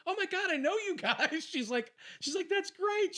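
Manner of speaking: high, laughing fan gush; imitating excited agent. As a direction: squeal it like a delighted fan, laughing. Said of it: "She goes".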